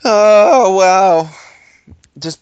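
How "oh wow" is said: loud, high, shocked gasp of wow. Real, not acted. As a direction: react as if appalled. Reacting to gun plan.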